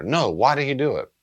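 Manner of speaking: a grieving mother demanding an answer; insistent demand. Retold or imitated. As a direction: press the why again. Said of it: "woman whose son committed suicide".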